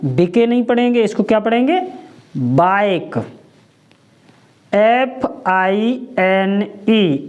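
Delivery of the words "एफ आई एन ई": letters spelled slowly one by one; patient letter-by-letter spelling. Shown as a demonstration. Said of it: "इसको हम क्या पढ़ेंगे".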